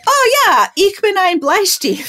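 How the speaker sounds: high, loud, drawn-out German showing off; mock-confident boast. Newcomer showing churchwomen her German, with mock confidence.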